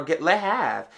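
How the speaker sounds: ordinary talk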